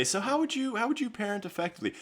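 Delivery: lightly hammed-up, high-pitched questioning; interviewer questioning guests. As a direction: pose it like a mock host to a guest. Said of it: "bring some people on and say".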